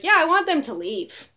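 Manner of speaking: loud, high, forceful comeback; emphatic retort. Retold or imitated. Marcher telling a reporter she wants men gone, emphatic retelling.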